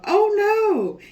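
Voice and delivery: high, loud beaten lion's cry; lion yelling. A narrator's reading. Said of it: "till Lion yelled".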